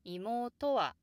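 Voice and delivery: 妹は peaking on と before the drop; emphasized TO. Shown as a demonstration. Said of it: "to could be a bit emphasized".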